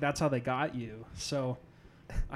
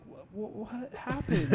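surprised; 7 semitones higher than usual. Retold or imitated. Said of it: "she sounded surprised".

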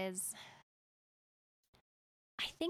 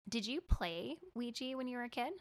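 ordinary talk

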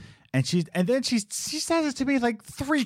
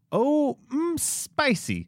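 mock customer, high and savoring; lightly voiced church diner tasting food; 14 semitones higher, much slower than usual. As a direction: put on a prissy diner voice. Mocking church crowd customers.

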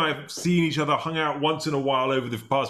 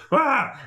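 mock scream; 11 semitones higher, 4 dB louder than usual. Mock scare scream in story.

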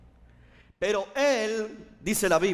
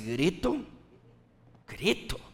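retold bemused pondering, pitched down; puzzled reaction; 4 dB quieter than usual. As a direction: mull the word over, mildly baffled. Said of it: "Y yo me quedé así".

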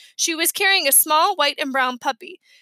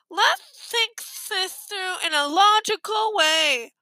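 slow, high head-cold delivery; stuffed-nose voice; 6 semitones higher, slower than usual. A narrator's reading. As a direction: urge order while pinching your nose. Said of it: "they had the worst colds".

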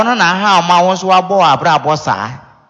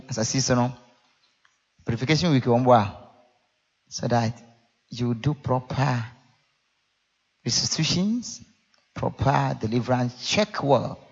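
hushed, much softer prayer tone; 5 semitones lower, 11 dB quieter than usual. Acted. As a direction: pray it quietly. Soft prayerful exhortation.

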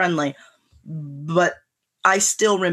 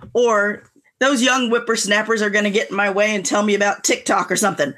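ordinary talk, nothing marked

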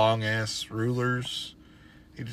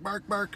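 high spoken "bark, bark"; imitated barking; 9 semitones higher than usual. Owner showing how his dog barks, imitated barking. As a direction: mimic a dog with the word.

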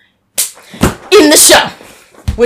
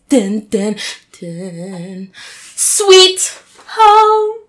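dramatic announcement; much slower than usual. Pretend. Dramatic title reveal.

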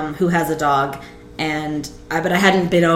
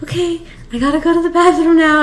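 much higher, gentle announcing; high careful coax; 10 semitones higher, faster than usual. Retold or imitated. To the clingy dog, a high careful announcement. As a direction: ease it out to the dog.

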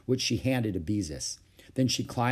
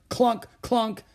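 high-pitched pile driver clunks; mild shout; 11 semitones higher than usual. A narrator's reading. Ramona playing a pile driver, high mild shout. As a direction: call out the machine noise, pitched up. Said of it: "shouted Ramona".

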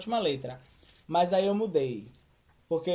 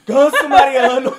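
high, loud, drawn-out playful insult; mock insult shout; 5 semitones higher, 13 dB louder, slower than usual. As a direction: bellow a silly insult theatrically. Xingamento com entonação, na brincadeira. (xingamento gritado de brincadeira)

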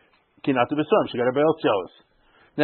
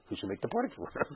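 hushed, puzzled king; quiet king voice; 6 semitones lower, 17 dB quieter, faster than usual. Retold or imitated. Teacher voices the puzzled king, quietly and lower. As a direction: murmur it like a wondering king. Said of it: "The king is saying like this".

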